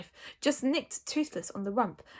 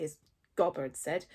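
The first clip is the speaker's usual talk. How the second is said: plain reading voice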